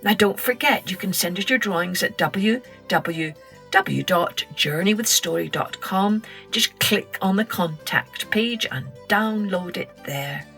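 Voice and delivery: usual speaking voice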